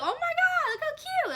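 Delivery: high-pitched excited squeal of words; delighted gushing. Retold or imitated. Mother first seeing baby's hair, delighted gushing.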